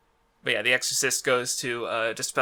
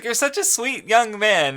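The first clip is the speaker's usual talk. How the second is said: mock crying Bisky; 8 semitones higher, 4 dB louder than usual. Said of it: "like, crying".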